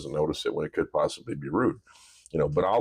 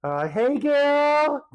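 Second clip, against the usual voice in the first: put-on much higher, louder, slow sing-song hey girl; daughter's sing-song greeting; 4 dB louder, much slower than usual. Dad imitating his fourteen-year-old's high bubbly greeting. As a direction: mimic a teen girl's bubbly greeting. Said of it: "she goes".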